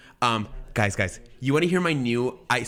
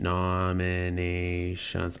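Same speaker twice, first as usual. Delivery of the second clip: very slow, low stretched syllables; low absent-minded drone; drawn-out muttering; 5 semitones lower, 4 dB quieter, much slower than usual. Real, not acted. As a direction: drag the word out while searching. Stalling while searching the web page.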